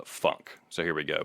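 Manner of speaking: usual speaking voice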